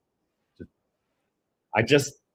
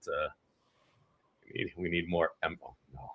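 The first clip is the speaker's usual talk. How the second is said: usual speaking voice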